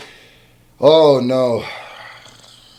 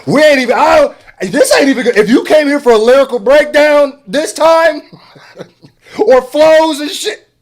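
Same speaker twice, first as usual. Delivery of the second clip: louder and higher, half laughing, all worked up; overwhelmed gushing; 10 semitones higher, 5 dB louder than usual. Real, not acted. Reacting to the song for dads, loud laughing overwhelmed gush. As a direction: gush it out, laughing through the rush of feeling. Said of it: "I'm on the verge of tears".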